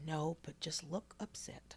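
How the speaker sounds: unvoiced, slow conspiratorial whisper. Teacher secretly letting a student off, slow whisper. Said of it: "She said".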